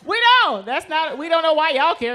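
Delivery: loud, high-pitched insistence; insistent protest. Acted. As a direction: protest loudly, starting very high. Mock protest at shocked audience.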